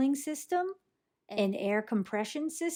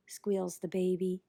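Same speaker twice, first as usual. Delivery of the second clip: plain reading voice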